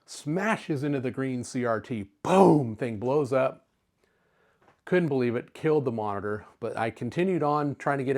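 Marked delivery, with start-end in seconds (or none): 2.2-2.7 a loud, punchy boom; mock explosion. Telling viewers how the monitor blew up, loud mock boom. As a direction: shout it like the tube blowing up.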